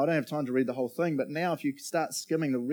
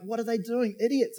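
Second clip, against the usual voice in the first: exasperated reader; 9 semitones higher than usual. Retold or imitated. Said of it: "You're like".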